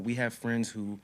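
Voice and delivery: ordinary talk, nothing marked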